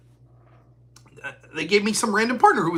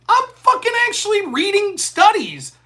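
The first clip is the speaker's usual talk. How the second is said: higher and louder, indignant; indignant retort; 12 semitones higher, 5 dB louder than usual. Retold or imitated. Answering opponents in the debate, loud indignant retort. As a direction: fire back in exasperation. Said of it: "I'm like".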